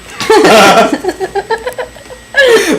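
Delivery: high, helpless burst of laughter; long high cackling laugh; big laugh. Real, not acted. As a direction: crack up at your own punchline. Cracks up at his own punchline.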